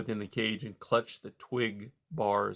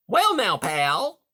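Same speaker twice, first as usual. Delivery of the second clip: much higher, louder hearty voice; hearty beaver; 9 semitones higher, 6 dB louder, slower than usual. A narrator's reading. Beaver Mister Conrad greeting caged Rye, high and hearty. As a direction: boom out a jovial salesman beaver. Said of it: "with his usual heartiness".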